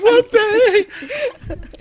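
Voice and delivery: loud, high squeal; hammed-up what the heck; mock outrage. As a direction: act shocked at the heat. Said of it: "I was like".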